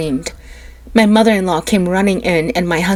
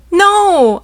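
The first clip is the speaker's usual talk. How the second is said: much higher, loud no; mother's wailing no; anguished cry; 12 semitones higher, 7 dB louder than usual. A narrator's reading. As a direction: wail it out as strength fails. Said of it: "I cried".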